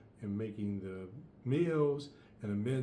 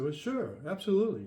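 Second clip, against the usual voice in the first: cocky agreement; 6 semitones higher, 4 dB louder than usual. Acted. Invader agrees to war.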